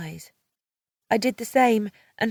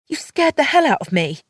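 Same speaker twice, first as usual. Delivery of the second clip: higher, louder scolding snap; snarling; 7 semitones higher, 6 dB louder than usual. A narrator's reading. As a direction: snap at him after the fright.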